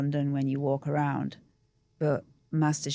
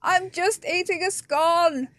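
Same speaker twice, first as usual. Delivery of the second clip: much higher, slow, put-upon sing-song; mock innocence; 11 semitones higher, 5 dB louder, slower than usual. Diner telling startled staff off, mock innocent. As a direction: plead innocence, hammed-up. Mock protest while eating a scone.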